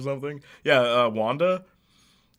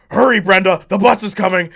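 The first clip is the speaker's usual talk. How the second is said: loud, raised read like a father; like his father; 7 semitones higher, 12 dB louder than usual. Retold or imitated. Actor redoing the line as his father would, loud. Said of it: "do it like your father would do it".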